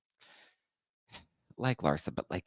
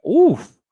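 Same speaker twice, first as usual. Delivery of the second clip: high loud mock wince; a sharp high oof at the shade; teasing oof; 12 dB louder than usual. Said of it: "And I was like".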